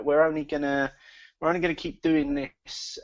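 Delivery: usual speaking voice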